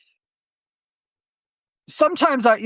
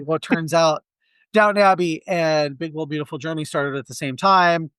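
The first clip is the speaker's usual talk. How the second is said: normal talking voice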